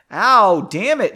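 sudden loud howl of pain. Acted. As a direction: yelp it out, hurt and angry. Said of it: "he howled".

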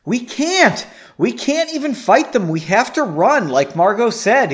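high, loud, pressing objection; alarmed insistence; urgent refusal. A narrator's reading. Urgent argument while fleeing, acted.

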